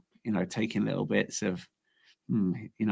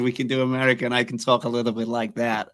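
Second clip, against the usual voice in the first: American accent. Shown as a demonstration. Guest put on the spot to do an American, American accent. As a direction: talk like an American. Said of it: "Can you do an American?".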